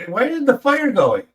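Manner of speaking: mock complaint, pitched well up; someone nagging. Relative coming over to the resting man, high-pitched nagging. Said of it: "someone will come over".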